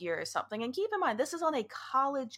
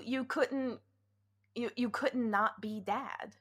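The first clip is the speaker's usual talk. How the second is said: ordinary talk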